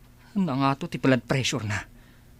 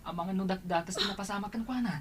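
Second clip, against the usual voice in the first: much softer, as if through a door; distant overheard voices; far-off hushed talk; 5 semitones higher, 10 dB quieter than usual. Acted.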